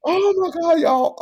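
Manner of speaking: high, loud exclamation to the room; loud high oh my God. Real, not acted. Telling friends about the scary ride, loud and high. Recalling fear dramatically.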